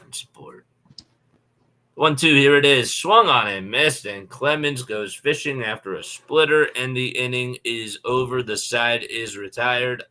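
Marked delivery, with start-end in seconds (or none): 1.9-10.0 loud, lively baseball call; play-by-play call. Acted. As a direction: call the pitch like a broadcaster.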